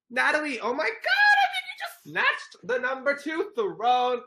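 very high, squealed astonishment; gushing high-pitched shout; amazed excitement. Real, not acted. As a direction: squeal it like a thrilled fan. Amazed at viewer's big gift.

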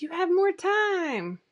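high, slow, sing-song mock advice; mocking the platitude. Vlogger mocking advice she keeps hearing, in a sing-song mock tone. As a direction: parrot the cliché with scorn. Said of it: "This whole, like".

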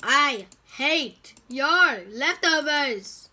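silly put-on voice. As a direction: stretch each word in a ridiculous voice. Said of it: "Why am I talking like that?".

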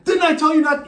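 high, loud scolding; scolding dad. Retold or imitated. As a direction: snap it at the kid.

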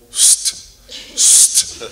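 loud, unvoiced wake-up "sst" through the teeth; sharp "psst" hiss. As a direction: imitate Dad hissing to rouse the boys. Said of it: "he would go".

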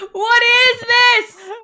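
high, slow, shrieking through laughter; laughing shriek. Real, not acted. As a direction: cry out while cracking up. Shocked by a funny avatar.

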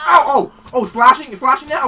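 loud startled indignation; startled outrage. Real, not acted. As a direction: yell back at the slashing monster. Enemy slashing at her.

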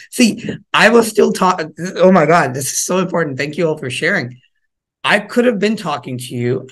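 normal talking voice

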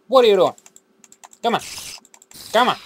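frustrated shout at game; annoyed outburst. Real, not acted. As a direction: yell at the mob in disbelief.

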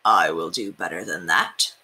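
deep dad voice, teasing; Papa's voice. A narrator's reading. Papa answering his sons, low and fatherly. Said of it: "said Papa".

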